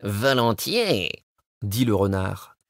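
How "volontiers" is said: slow, drawn-out fox voice; sly fox. Acted. Le renard accepte. (le renard accepte, lentement et rusé)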